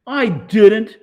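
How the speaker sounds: high, very loud denial; shrill denial. Acted. Mars Bar denies it again to Amanda, high loud protest. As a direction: squeal it out, cornered.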